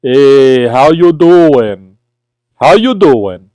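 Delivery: showy Brooklyn greeting, drawn out; Brooklyn accent. Shown as a demonstration. Streamer proving Brooklyn roots to viewers, thick Brooklyn accent. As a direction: lean hard into the Brooklyn accent, stretching the vowels. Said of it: "Can't you tell from my Brooklyn accent".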